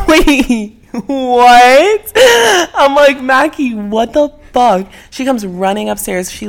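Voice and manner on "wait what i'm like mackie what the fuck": stunned. Retold or imitated. Stunned reaction retold.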